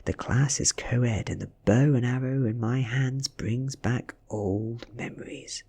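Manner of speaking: plain reading voice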